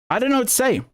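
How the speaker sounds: high-pitched exasperated disbelief; at a loss. Real, not acted. Commentator reacting to an outrageous clip, at a loss. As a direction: throw it up high, lost for words.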